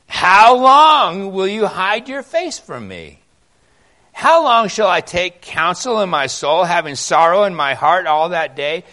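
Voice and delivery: loud anguished reading from the pulpit; impassioned plea. Acted. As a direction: cry out like a desperate psalmist.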